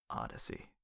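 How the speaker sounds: breathy whispered name. Acted. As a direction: breathe the word out low. Villain reads name off paper.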